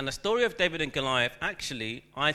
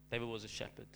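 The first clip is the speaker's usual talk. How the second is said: softer, letting it sink in; soft repeat; 10 dB quieter, faster than usual. Real, not acted. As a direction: say it again, quietly. Reflective repeat, dropped soft.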